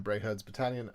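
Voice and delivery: normal talking voice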